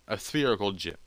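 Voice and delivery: usual speaking voice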